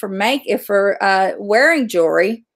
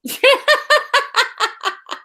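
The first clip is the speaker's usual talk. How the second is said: high burst of laughter; 13 semitones higher than usual. Real, not acted. Boss asks what a ninny is.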